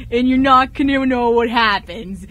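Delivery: ordinary talk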